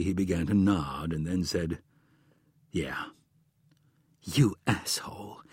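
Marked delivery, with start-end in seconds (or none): 2.7-3.1 quiet admission. Acted. Husband quietly admits lying. 4.2-5.4 hushed, stunned whisper; shocked whisper; whispered insult. Acted. Wife stunned by husband's lie.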